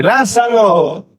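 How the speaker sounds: high, drawn-out call; calling Lázaro. Acted. Jesus calling dead Lázaro, long and high. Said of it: "Él dice".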